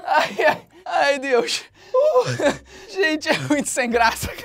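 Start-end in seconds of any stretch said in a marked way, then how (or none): none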